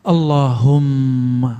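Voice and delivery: Allahumma slow, the mm held long; model reading. Shown as a demonstration. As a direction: read Allahumma slowly, lingering on the doubled m. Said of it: "Jadi gimana cara bacanya".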